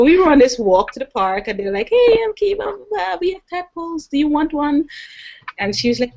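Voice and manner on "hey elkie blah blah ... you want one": lightly voiced eager boys, higher; kids calling out. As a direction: twin boys offering a tadpole to Elkie, mild high kid voice. Neighbour twin boys offering tadpoles.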